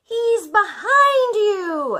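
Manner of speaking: high, slow shout with a laugh; children shouting. Retold or imitated. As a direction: shout the panto warning like excited children. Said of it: "all the children will shout".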